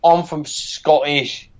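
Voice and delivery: a clumsy, slowed attempt at a Scottish accent; slow, failing put-on Scottish accent; bad Scottish accent. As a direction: try a Scottish accent and miss. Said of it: "I can't do it".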